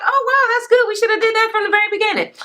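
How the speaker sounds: high, fast, gushing imitation; squealing fake delight; mock Sheree gushing. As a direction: gush it out like an excited Sheree. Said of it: "And Sheree was like".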